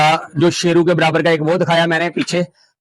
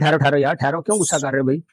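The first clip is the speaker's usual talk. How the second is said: softer, lower hushing; soothing; 3 semitones lower, 5 dB quieter than usual. Real, not acted. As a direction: settle the angry bull gently. Soothing an angry bull.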